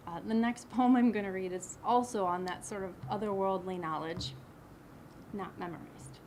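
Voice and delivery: ordinary talk, nothing marked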